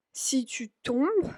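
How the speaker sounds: susurra la letra en francés; susurro. Shown as a demonstration. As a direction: dilo casi sin voz, en secreto. Susurra la frase de la canción. (frase de la canción susurrada)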